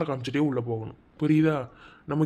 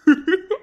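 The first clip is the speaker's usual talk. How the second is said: burst of laughter; 14 semitones higher, 8 dB louder than usual. Acted. Husband laughs at wife's scolding.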